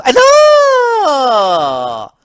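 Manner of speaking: long, loud high cry sliding down; gleeful boast. Acted. Luigi boasting to Mario, gleeful shout.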